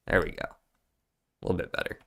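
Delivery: breathy whisper to herself; barely voiced, almost no tone; whispered aside. Real, not acted. Adjusting the map, muttering to self.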